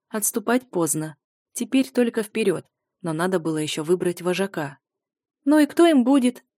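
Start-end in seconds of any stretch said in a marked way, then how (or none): 5.4-6.4 high, loud squeaky voice. Acted. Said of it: "пропищала Пип".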